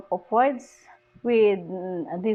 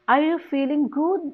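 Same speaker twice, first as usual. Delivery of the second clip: high question rising on "good"; stress on GOOD; 5 semitones higher than usual. Shown as a demonstration. Teacher shows tonic stress in a question, stress on "good". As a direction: lift "good" at the end. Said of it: "The stress is on the good".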